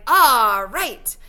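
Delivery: loud, drawn-out cheer; excited shout. A narrator's reading. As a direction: shout it out in delight at the fireworks. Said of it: "and Freak is shouting".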